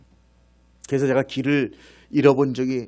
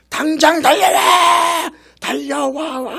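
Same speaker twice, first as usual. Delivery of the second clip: slow, very loud, much higher bellowed order; furious shout; 10 dB louder, much slower than usual. Retold or imitated. Angry company commander ordering the lost platoon, bellowing. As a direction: roar the command down the valley. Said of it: "화가 나가지고".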